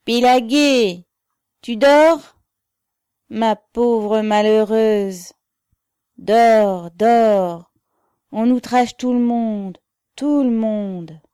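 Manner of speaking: put-on loud, high, drawn-out drunken calls; drunken shouting. A narrator's reading. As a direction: holler slurringly up at a window, dragging the words. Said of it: "crier d'une voix avinée".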